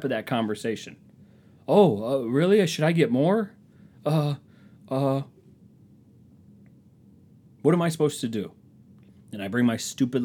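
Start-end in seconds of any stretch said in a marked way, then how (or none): 1.7-8.5 higher, halting mock confusion; flustered reply. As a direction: play lost for an answer, pitched up. Acting out his awkward reply.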